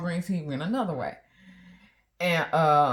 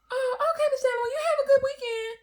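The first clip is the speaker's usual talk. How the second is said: much higher, fast, curt brush-off; deflated teller; faster than usual. Retold or imitated. Teller cuts chat short once she hears of wife, much higher and fast. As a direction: hurry the goodbye, cooled off. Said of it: "her whole tone changed".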